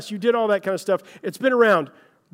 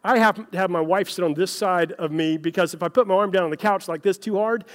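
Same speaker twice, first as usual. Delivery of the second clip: ordinary talk, nothing marked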